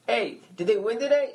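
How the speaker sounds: a friendly stranger's greeting in a put-on Boston accent. Bar patron greets the lone Royals fan, mock Boston accent. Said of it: "I gave a Boston accent".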